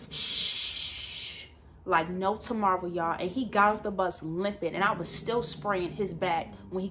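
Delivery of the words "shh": long unvoiced "shh" spray noise; imitated spray hiss. Woman imitating the mace going off, long hiss. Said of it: "and I was like".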